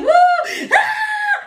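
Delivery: high mock scream; imitated screaming. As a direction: whoop turning into a very high scream. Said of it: "he starts screaming".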